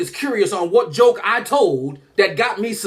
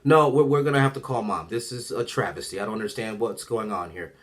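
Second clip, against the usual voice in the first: low, stern official voice trailing softer; grave principal impression, dropping quieter; school principal; 8 semitones lower, 4 dB quieter than usual. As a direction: play a disapproving principal in a lowered voice. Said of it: "My principal already on the phone like this".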